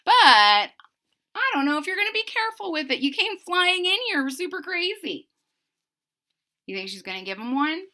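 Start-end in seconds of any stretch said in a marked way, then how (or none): 0.0-5.3 high, indignant bird lady; high bird voice, annoyed; giant bird scolding. Acted. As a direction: scold them as the big bird. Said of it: "she's like".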